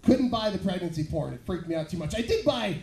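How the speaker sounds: ordinary talk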